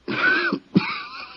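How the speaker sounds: harsh, wracking sick cough; a dying man's chest cough; hacking cough. Acted. Dying inmate coughing hard while talking to Johnny.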